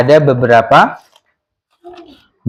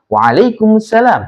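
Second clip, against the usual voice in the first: "Waalaikumsalam" dropping at the end; falling tone; 5 semitones higher than usual. Shown as a demonstration. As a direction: bring the end of "Waalaikumsalam" down as a statement. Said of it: "Kemudian jawabnya juga sama". (nada turun di akhir "Waalaikumsalam")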